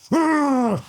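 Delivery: high, drawn-out "argh"; imitated roar. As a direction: mimic a kid roaring back at a zombie. Imitates son roaring at zombie.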